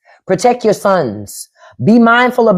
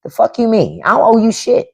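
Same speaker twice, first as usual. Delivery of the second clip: a hint of a woman snapping back, higher; mock woman rebuff; 7 semitones higher than usual. A woman lightly voiced rejecting a demanding young man. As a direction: brush him off as a fed-up woman. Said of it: "what them women gonna say".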